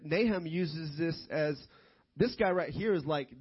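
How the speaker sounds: ordinary delivery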